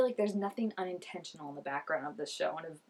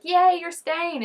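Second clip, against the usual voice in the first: high, loud happy yay; imitated cheer; 8 semitones higher, 9 dB louder, slower than usual. Everyone welcoming her staying, imitated as a high, loud cheer. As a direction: cheer like a delighted group.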